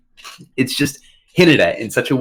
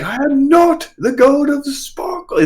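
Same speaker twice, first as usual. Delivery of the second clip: high, drawn-out indignant protest; mad; slower than usual. Retold or imitated. Thor objects to being called god of sparkles, mad. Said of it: "he's so mad".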